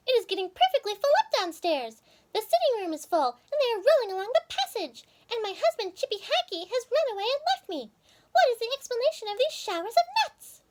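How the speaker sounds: high, fussy chipmunk voice; chipmunk wife. Acted. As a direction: complain about the nuts, pitched high. Mrs Chippy complains.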